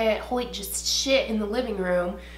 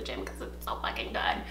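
whispered gush; 9 dB quieter than usual. Real, not acted. Gushing about show.

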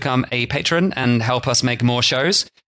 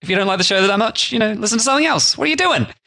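rising high mock exasperation; mock rant; 8 semitones higher, faster than usual. Real, not acted. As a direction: rant higher and higher at the listener. Comic rant at bad raters.

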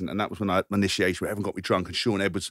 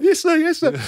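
mock obedient recruit; 5 dB louder than usual. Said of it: "I'm like".

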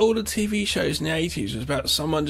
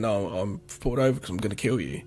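flat, unmoved mock threat; deadpan; 5 semitones lower, 4 dB quieter than usual. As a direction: say it with no emotion at all. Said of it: "really deadpan".

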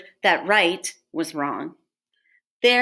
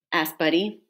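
plain reading voice

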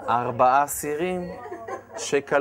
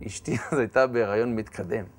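normal talking voice

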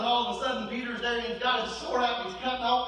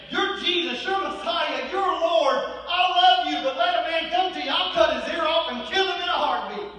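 loud, higher boastful Peter; voicing Peter; 7 semitones higher, 6 dB louder than usual. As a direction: belt out Peter's bold loyalty.

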